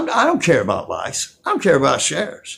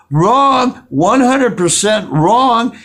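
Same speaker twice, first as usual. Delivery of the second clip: emphatic loud rebuttal; 5 semitones higher, 4 dB louder, much slower than usual. Real, not acted. Angry rebuttal of a quote.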